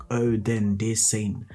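put-on slow, grand Victorian accent. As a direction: read it like a stage Victorian. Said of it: "my Victorian accent".

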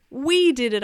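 high, loud cheer; triumphant shout. Acted. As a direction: shout it in shared victory. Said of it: "I shouted".

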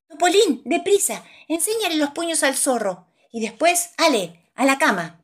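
urgent high call to hurry; llamada apremiante. Acted. Narrador urge al personaje. (llamada urgente y aguda)

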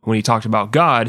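ordinary talk

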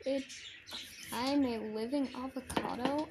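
ordinary talk, nothing marked

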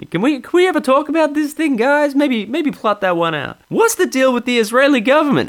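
mock Seinfeld's cadence; hammed-up Seinfeld parody; Jerry Seinfeld impression. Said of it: "my pseudo Jerry".

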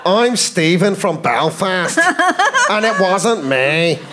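put-on slow Northern Irish accent; slow, deliberate Belfast lilt. Suspect introducing himself, slow Belfast accent. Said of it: "can I ask about accents".

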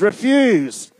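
forceful, high-pitched REFUSE for the crowd to echo; emphatic. Shown as a demonstration. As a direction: REFUSE shouted out, loud and high. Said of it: "Say it with emphasis".

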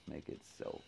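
breathy whispered repeat; whispered echo. Pretend.

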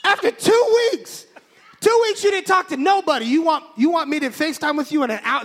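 very high, loud mock outrage; outraged inner voice. His brain protesting the FaceTime request, mock outraged. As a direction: explode in incredulous protest, dragging the first words. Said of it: "This is what's going on in my brain".

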